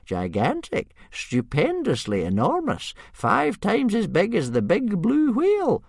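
slow, swooping, climbing to very high pitch; happy relish. A narrator's reading. Old Wrinkly outdoes the chief describing the dragon, happily. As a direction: pile up the words with glee. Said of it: "interrupted Old Wrinkly happily".

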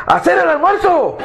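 wife shouting at her husband to cook; yell. Retold or imitated. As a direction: bark the command. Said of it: "le pegó un grito".

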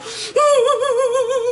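high laughing sob; retelling crying in the theater, a laughing sob. Retold or imitated. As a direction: laugh through mock tears.

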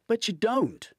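high, loud, hammering the point; drilling retort. A narrator's reading. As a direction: cut him off hard. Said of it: "Hunter drills".

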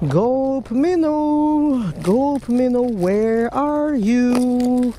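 high, slow singsong calling for the bait; singsong search call. Real, not acted. Angler calling for his bait in a high, slow singsong. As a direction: croon it out like calling a lost pet.

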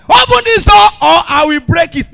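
high, loud banging yell; armed robber shout. Retold or imitated. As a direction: pound the door and roar. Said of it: "Armed robbers".

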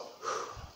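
long, airy calming exhale; deep breath out. Real, not acted. Pausing after getting worked up, deep sigh. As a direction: blow out a breath to settle down. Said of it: "take a deep breath".

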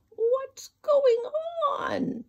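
a woken squirrel yawning out the question; sleepy upset squirrel. Acted. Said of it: "yawned a sleepy and very upset squirrel".

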